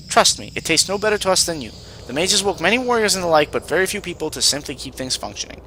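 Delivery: tired, harried cook. Acted. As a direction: complain like a put-upon servant. Voices overworked, clumsy cook.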